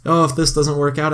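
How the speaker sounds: ordinary talk, nothing marked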